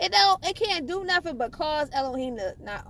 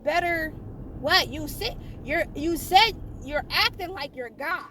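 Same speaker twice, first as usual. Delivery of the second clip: ordinary talk, nothing marked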